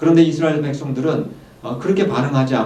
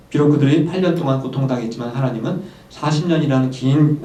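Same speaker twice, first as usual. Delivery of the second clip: ordinary talk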